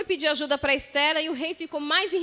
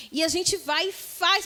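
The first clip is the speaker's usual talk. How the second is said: normal talking voice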